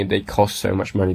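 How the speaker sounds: ordinary talk, nothing marked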